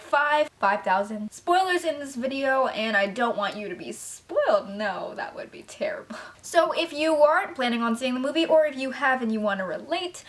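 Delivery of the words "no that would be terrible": whispered aside with a giggle. Real, not acted. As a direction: whisper it like a secret to the viewers. Jokey aside to viewers.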